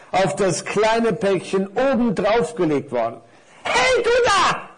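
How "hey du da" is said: very high, loud squeaky call; little parcel calls out indignantly, high and loud. A narrator's reading. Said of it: "rief das kleine Päckchen". (hohes, lautes Rufen)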